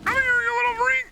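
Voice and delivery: high cartoon voice begging to kill; pleading in a silly falsetto; high-pitched mock begging; squeaky falsetto. Squeaky voice while fighting.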